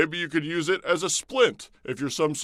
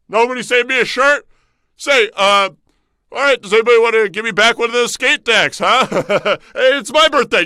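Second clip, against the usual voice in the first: loud, high, hammed-up begging; mock whining plea; 7 semitones higher, 7 dB louder than usual. Host begging the audience for a skate deck, loud high whine. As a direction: whine like a kid denied a present.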